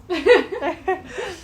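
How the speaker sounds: high burst of laughter after a joke. Real, not acted. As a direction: laugh out at your own tease.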